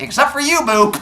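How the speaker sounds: high-pitched sassy putdown; dismissive coach. Acted. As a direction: brush them off with high mock sweetness. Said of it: "And he's like".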